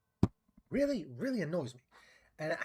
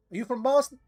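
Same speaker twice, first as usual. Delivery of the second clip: high, loud American accent; brash American question; 8 semitones higher, 9 dB louder than usual. Shown as a demonstration. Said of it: "when I do an American accent".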